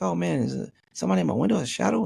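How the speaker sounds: rushed, hammed-up worry; mock alarm. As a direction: play at being spooked by a shadow. Acting out fright at a shadow.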